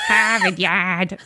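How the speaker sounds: slow, drawn-out mock Boston accent. Game master echoing the players, in a drawn-out Boston accent. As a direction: stretch the Boston vowels. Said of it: "maybe from Boston".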